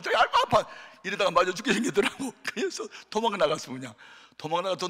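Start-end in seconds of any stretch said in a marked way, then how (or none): 0.0-0.7 much higher, louder mock yelp of pain; a child yelping as the mother hits him; pained cry. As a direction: cry out like a beaten kid. Child crying out while beaten.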